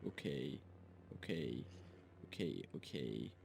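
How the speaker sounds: low, hoarse warm-up okays, trying out the throat; testing a sore voice. Real, not acted. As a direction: croak it out low to check the voice still works. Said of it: "see, my voice might die".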